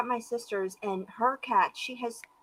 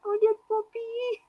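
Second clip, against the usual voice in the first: put-on baby talk; 12 semitones higher than usual. Cooing at cat like puppy.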